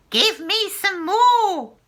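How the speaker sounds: high, loud, slow demand; greedy crone begging; demanding cry. Acted. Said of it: "she cried".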